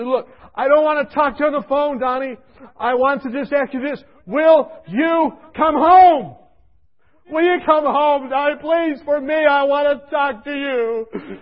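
high, loud, slow tearful pleading; weeping mother. Retold or imitated. A mother on the phone begging to know he is safe, tearful. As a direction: plead through tears for him. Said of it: "She's praying and crying".

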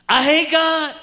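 shouted high and loud, raw; anguished outburst. Retold or imitated. Son blurting to his dad, shouting. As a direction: blurt it out in full force.

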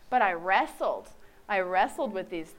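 ordinary delivery

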